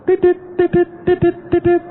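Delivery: high, loud electronic beeps from the mouth; watch alarm beeping. Retold or imitated. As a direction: mimic a wristwatch alarm going off. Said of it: "alarmnya berbunyi". (menirukan bunyi alarm jam tangan)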